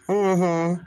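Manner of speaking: ordinary delivery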